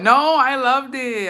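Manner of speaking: high, slow, emphatic insistence; high insistent. Acted. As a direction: stretch out the no, up high. Insisting to a viewer.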